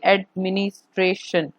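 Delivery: एडमिनिस्ट्रेशन in measured syllables, lightly separated; read out by syllables. Shown as a demonstration. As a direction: read एडमिनिस्ट्रेशन carefully, part by part, as ad-mi-nis-tra-tion. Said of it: "इसको ठीक से पढ़ भी पाएंगे". (एडमिनिस्ट्रेशन को अक्षर-अक्षर करके ठीक से पढ़ें)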